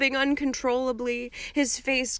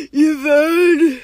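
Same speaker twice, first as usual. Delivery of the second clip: bellowed; 6 semitones higher, 12 dB louder than usual. A narrator's reading. Weeping Hagrid bellows as he hugs Harry, very loud. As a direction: bellow out a name in grief. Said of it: "he bellowed".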